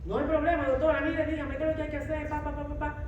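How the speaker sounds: hammed-up confidence, brushing off the news; brave front. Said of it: "fuerte, valiente".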